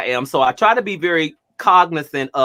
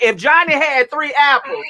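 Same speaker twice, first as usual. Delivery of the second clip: mocking yelling teacher; 11 semitones higher than usual. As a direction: ham up the shouty tutor. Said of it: "someone yelling at you".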